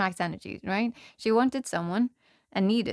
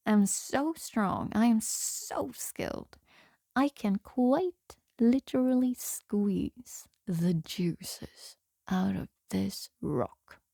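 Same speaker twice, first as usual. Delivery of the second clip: slow, drawn-out bragging with weighty pauses; mock boasting; 3 dB quieter, much slower than usual. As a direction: play a little trickster bluffing the troll, very deliberately. Little hero boasts to the troll.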